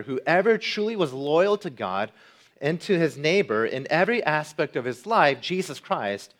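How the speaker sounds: full-throated pulpit proclamation; impassioned preaching. Acted. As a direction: preach it with growing fervor. Rising preaching climax.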